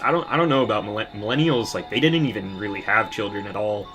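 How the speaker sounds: usual speaking voice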